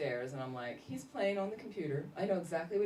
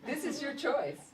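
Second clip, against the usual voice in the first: put-on higher, bright-eyed pitch to a kid; sister-in-law making cleanup sound exciting; mock excited; 8 semitones higher, slower than usual. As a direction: sell the choice like a treat. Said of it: "She'd kinda make it all exciting".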